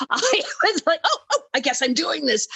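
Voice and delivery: laughing it off. Real, not acted. Laughing at getting the part.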